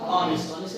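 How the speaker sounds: "ऑनेस्ट" opening on the vowel, no H; silent H. Shown as a demonstration. Teacher confirming the students' answer, "ऑनेस्ट" with silent H. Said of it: "क्या बोलेंगे?".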